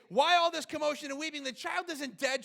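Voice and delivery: high, loud acted challenge; challenging question. Acts Jesus challenging the mourners.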